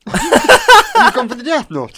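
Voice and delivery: loud, slow, high disbelief; surprised shout. Real, not acted. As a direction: yell it in shock. Shocked at friend's choice.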